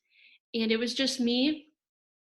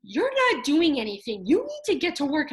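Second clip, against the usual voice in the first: louder, mock-stern teacher telling her off; teacher scolding; 4 semitones higher, 7 dB louder than usual. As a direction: imitate a bossy teacher, half joking. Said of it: "And she'd be like".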